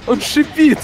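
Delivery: high frightened yelp; scared cry. Real, not acted. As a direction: squeal it in alarm. Scared by hissing monster.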